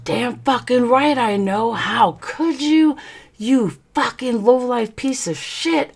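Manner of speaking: high, strained fury without shouting; retold ballistic outburst. Wife tearing into cheating husband, retold outburst. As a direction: re-enact the tirade high and tight. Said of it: "I went ballistic".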